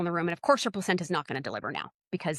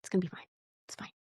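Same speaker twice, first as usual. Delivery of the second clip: soft reassuring; 9 dB quieter, faster than usual. Retold or imitated. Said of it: "And I'm like".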